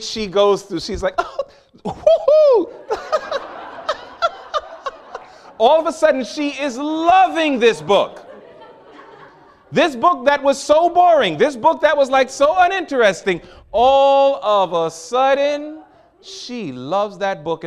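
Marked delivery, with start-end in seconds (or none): 1.2-2.7 very high, loud, stretched woohoo with a laugh; gleeful whoop. Retold or imitated. Student reading late at night, whooping with joy. 2.8-5.5 high, breathless squealing giggle; giddy laughter. Retold or imitated. Student laughing with delight over the book. 5.6-17.6 high, loud, stretched, exultant; rising preaching excitement. Acted. Preacher exults about the boring book turned beloved.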